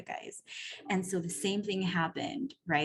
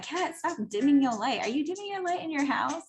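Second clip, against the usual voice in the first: higher, teasing mock scolding from the coach; a coach playfully calling her out, pitched up; joking scold; 8 semitones higher than usual. Coach teasing her client, a playful higher scold.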